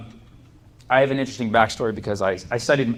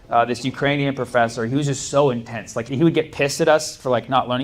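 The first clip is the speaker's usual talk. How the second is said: ordinary talk, nothing marked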